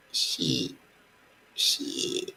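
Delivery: low hushed "shit, shit"; whispered curse. Real, not acted. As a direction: curse under your breath.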